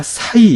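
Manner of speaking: 사이 glided as a single vowel sound; 사이 in one syllable, no break; diphthong as one. Shown as a demonstration. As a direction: run 사 and 이 together in 사이. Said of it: "이렇게 읽어야 되죠".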